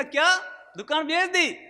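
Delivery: high, startled gasp of a question; shocked disbelief. Retold or imitated. Father hearing his shop was sold, shocked and high. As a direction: jolt up in pitch as the news lands.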